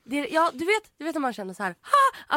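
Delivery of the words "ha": sharp high "Ha!"; mock ticklish yelp; sudden laugh burst. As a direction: bark a quick startled laugh. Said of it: "att man typ börjar skratta".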